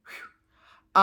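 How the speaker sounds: long voiceless whew to hold back tears; emotional breath out. Real, not acted. Telling viewers about her grandpa's death, breathy whew. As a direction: blow the feeling out slowly. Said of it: "I wasn't gonna get emotional".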